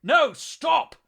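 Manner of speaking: high, very loud yell of alarm; urgent full-voiced bellow; shouted warning. A narrator's reading. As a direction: shout a desperate warning over the din. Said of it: "The Astartes' warning was lost amidst the entity's bellows".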